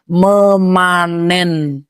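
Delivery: memanen stretched out syllable by syllable; stress on memanen. Real, not acted. Preacher tells women Ramadan is for harvesting, memanen heavily drawn out. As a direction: draw out every syllable of memanen, holding it long.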